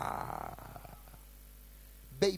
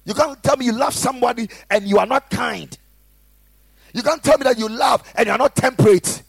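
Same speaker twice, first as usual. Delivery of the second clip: loud, high, fervent sermon delivery; full-force preaching; 8 semitones higher, 18 dB louder than usual. Acted. Pastor exhorting the congregation, loud fervent preaching. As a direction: preach it hard to the room.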